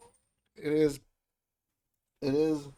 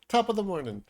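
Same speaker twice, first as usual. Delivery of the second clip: mock Irish greeting; 7 semitones higher, 4 dB louder than usual. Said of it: "Top of the morning is also Irish".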